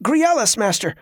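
high, strained and out of breath; a struggling boy gasps out the answer; panting boy. A narrator's reading. Boy panting while sparring.

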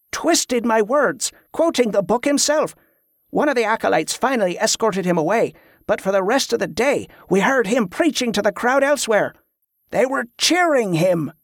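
high-pitched, aggrieved complaint; indignant follower. A narrator's reading. Merritt's indignant account of the preacher.

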